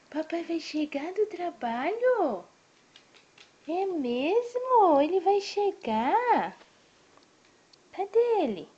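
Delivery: high, loud, slow, delighted sing-song. Real, not acted. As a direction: gush to the little one with wide-eyed excitement. Excited baby talk to child.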